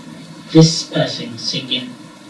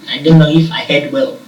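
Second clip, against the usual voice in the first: usual speaking voice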